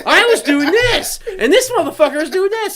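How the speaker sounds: high, loud indignant protest; hammed-up squabbling contestant; mock heated quarrel. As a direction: blame the other cook furiously. Acting cooks' quarrel.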